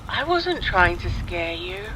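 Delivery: plain reading voice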